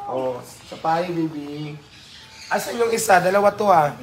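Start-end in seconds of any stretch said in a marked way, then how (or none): 0.1-1.7 soft, hushed coo to a pet; tender cooing endearment. Real, not acted. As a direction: murmur it lovingly to the animal. Cooing baby talk to animal.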